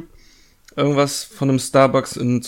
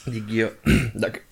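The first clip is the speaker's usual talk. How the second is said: hoarse after choking; 4 dB quieter, slower than usual. Real, not acted. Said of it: "ich kann nicht reden".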